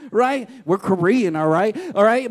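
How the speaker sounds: loud playful boast; loud proud boast. Real, not acted. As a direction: belt it like a proud volleyball mom. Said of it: "I'm so super loud".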